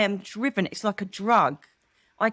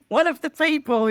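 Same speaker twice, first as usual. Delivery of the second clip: ordinary delivery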